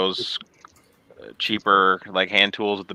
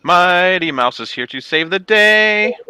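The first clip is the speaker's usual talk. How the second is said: high, slow heroic cartoon call; cartoon theme voice; 8 semitones higher, 4 dB louder, slower than usual. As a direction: belt it like an old cartoon hero.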